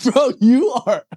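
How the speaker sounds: incredulous squeal. Real, not acted. Laughing disbelief.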